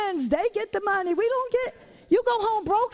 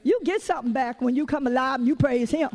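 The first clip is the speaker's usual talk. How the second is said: normal talking voice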